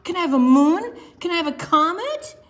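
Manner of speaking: high, loud, rising questions; eager begging. Acted. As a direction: bounce with excitement. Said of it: "Penguin pounced and clapped".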